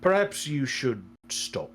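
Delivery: low, measured voice of counsel; concerned advisor Arno. Acted. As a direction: gently caution a foolish gambler.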